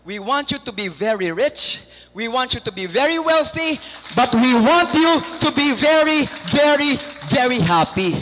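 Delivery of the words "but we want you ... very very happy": louder, building on very, very; emphatic blessing. Real, not acted. Preacher wishing the congregation true happiness, building louder. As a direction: swell it into a heartfelt wish. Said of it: "emphatic wish for the audience".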